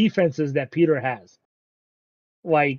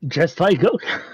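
words dissolving into laughter; laughing echo; 2 semitones higher than usual. Real, not acted. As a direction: echo the joke, cracking up. Echoes co-host's joke, laughing.

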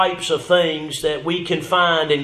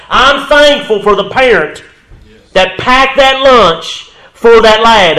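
louder, higher and slow, each word hammered; emphatic preaching; 5 semitones higher, 8 dB louder, slower than usual. Real, not acted. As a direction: drive the line home to the congregation. Point repeated with force.

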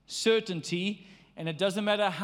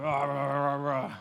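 grumpy growl; 6 semitones lower than usual. Acted.

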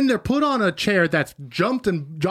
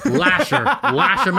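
laughing; 6 semitones higher, 3 dB louder, slower than usual. Real, not acted. Joking about lashes, laughing.

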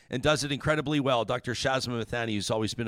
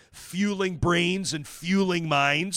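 slow, mocking slogan read, higher and louder; sarcastic; 5 semitones higher, 5 dB louder, much slower than usual. Real, not acted. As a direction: spell out the slogan with disdain.

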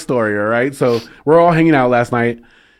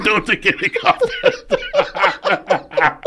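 slow, higher words breaking into laughter; helpless laughter; 4 semitones higher, slower than usual. Real, not acted. Podcaster repeating the barbershop roast, helpless laughter. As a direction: barely get it out for laughing.

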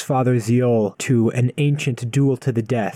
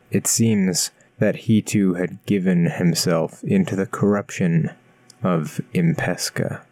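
story narration; 4 semitones lower than usual. A narrator's reading. Recap narration over music.

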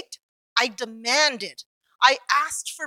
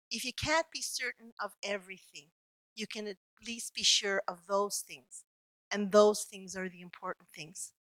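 soft reassurance; 3 semitones lower, 8 dB quieter than usual. Retold or imitated. Comforting the patient in hospital.

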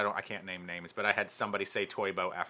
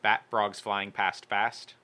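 slow careful; much slower than usual. Shown as a demonstration. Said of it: "careful slow attempt".

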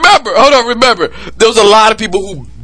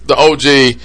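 ordinary delivery

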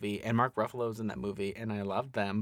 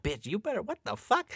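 shocked audience; 10 semitones higher than usual. Retold or imitated. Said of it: "making the noises like".

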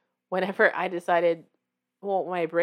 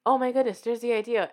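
gasp, then a higher excited burst; sudden realization; 4 semitones higher than usual. Acted. Stuck writer suddenly gets the idea, gasping excitedly. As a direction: act out an idea suddenly landing.

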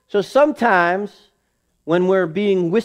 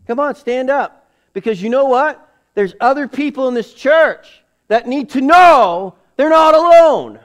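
preaching louder and higher, building to a shout; rising shout; 8 semitones higher, 7 dB louder than usual. Real, not acted. Pastor urging the church to stand, building to a shout. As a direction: call them to stand, building to full force.

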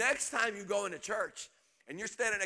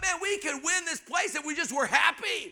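ordinary talk

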